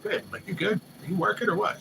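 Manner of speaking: high, mock worried asking; retold concerned question. Asking his girl why she was up so late, mock worried. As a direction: lightly play himself checking on her.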